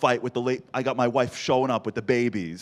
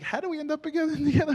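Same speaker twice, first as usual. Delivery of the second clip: wife voiced.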